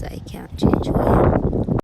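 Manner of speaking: normal talking voice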